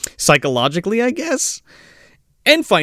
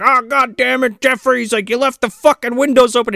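put-on grumpy old man; 10 semitones higher than usual.

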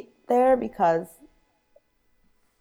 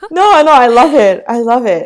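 excited; 4 semitones higher, 13 dB louder than usual. Real, not acted. Reassuring friend about voice.

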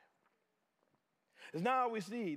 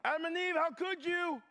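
mock yelling; 9 semitones higher than usual. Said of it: "He didn't start yelling".

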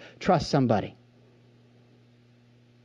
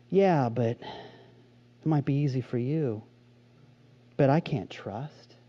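slow, lower whining voice; whiny doubter; 4 semitones lower, 5 dB quieter, slower than usual. Retold or imitated. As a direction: mimic a doubtful complainer. Sermon, voicing a doubting listener.

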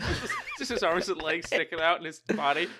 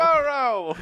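mock Texan; 8 semitones higher, 6 dB louder than usual. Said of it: "how the Texans would say it".

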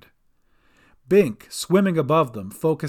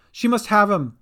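higher, louder call; 6 semitones higher, 5 dB louder than usual. A narrator's reading. Cat answers Gran from the boat, calling. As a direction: call across water in alarm. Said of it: "she called".

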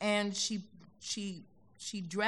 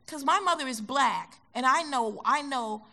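niece's insistent answer; 6 semitones higher, 9 dB louder than usual. Retold or imitated.

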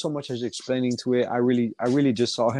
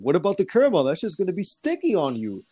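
mock objection; 7 semitones higher than usual. Acts out another coworker objecting.

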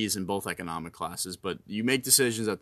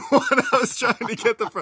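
laughing; 14 semitones higher, 8 dB louder than usual. Real, not acted. Laughing while shoveling frogs.

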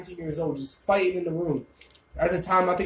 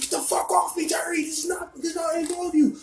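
high mock yell, struggling to get free; high-pitched protest; 11 semitones higher than usual. Friend pinned in a cradle hold, high mock protest. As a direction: imitate him yelling in a high, strained voice. Restrained man protesting in scuffle.

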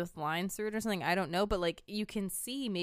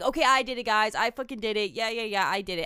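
louder, throwaway mock admission; joking confession; 2 semitones higher, 7 dB louder, faster than usual. Imitating the way Mike admits it, unserious joking. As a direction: own up loudly as if kidding. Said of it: "it's not serious. Like, he's just joking".

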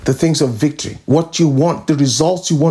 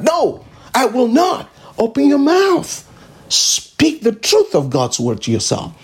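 fervent command; 7 semitones higher than usual. Real, not acted. Preacher exhorting congregation.

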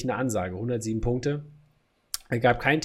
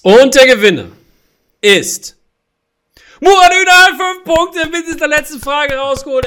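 hyped giveaway announcement; 13 semitones higher, 12 dB louder than usual. Acted. Host crowns the quiz winner.

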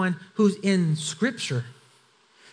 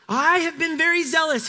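exasperated repeat; 7 semitones higher than usual. Acted. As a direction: say it again as if God wasn't listening. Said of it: "because obviously you were listening to me the last time".

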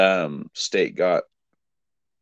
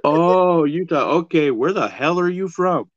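mock incredulous; 9 semitones higher than usual. Tom answers the imagined stranger from Utah, mock incredulous. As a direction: play up the baffled reply, pitched well up.

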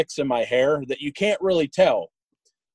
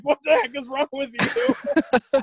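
high-pitched, laughing mock incredulity; amused disbelief; 11 semitones higher than usual. Barber to the country kid rapping [name], amused disbelief. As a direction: quote him in a high, drawn-out, tickled voice.

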